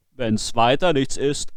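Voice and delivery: sly sexton offering help; sexton's voice. Acted. Sexton to the worried father, lightly voiced and sly.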